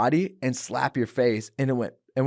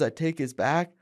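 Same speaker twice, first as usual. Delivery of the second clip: ordinary delivery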